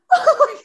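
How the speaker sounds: high, loud delighted laugh; shrieking laugh. Retold or imitated. Host reliving Brooke saying yes, high delighted laugh. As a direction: burst out laughing in joy.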